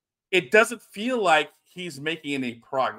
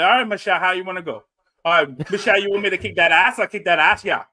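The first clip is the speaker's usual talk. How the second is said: hammed-up Cajun tough guy, louder; rowdy Cajun wrestler promo, loud; Cajun character; 2 semitones higher, 4 dB louder, faster than usual. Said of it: "we want you to be this Cajun character".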